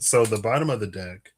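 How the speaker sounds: ordinary talk, nothing marked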